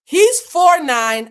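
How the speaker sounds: slow, loud, high-pitched emphasis; drawn-out incredulous shout; emphatic repetition. Real, not acted. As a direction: hammer each word slowly and loudly. Repeats the height difference emphatically.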